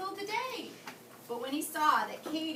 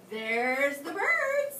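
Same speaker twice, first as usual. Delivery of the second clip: slow suggestion; 9 dB louder, much slower than usual. Acted. Freddie suggests slowly.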